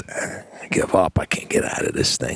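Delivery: whispered, resigned, like a beaten animal; defeated whisper. Pretend. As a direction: breathe it out, giving up. Imagined skunk giving up.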